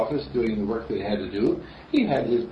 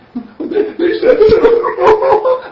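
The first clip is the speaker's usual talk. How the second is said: loud high burst of laughter; big laugh; 13 dB louder than usual. Real, not acted. Laughing out loud at the congregation. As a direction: let out a big laughing whoop.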